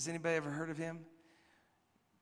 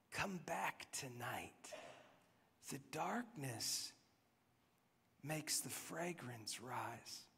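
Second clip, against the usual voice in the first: hushed gardener; 9 dB quieter, slower than usual. Retold or imitated. Gardener's line in song lyric.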